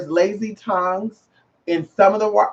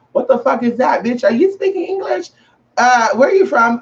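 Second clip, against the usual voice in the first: mock bewilderment, pitched up; baffled listener; 5 semitones higher than usual. Baffled outsider questioning a Gullah speaker, mock incredulous. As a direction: act out an incredulous, high-pitched challenge. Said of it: "why are you talking like that".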